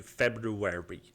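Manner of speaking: "February" said slowly, syllable by syllable; careful enunciation. Shown as a demonstration. Podcaster practising a tricky word, "February" slowly enunciated. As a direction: sound out "February" slowly and deliberately. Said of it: "properly enunciate ... say words like".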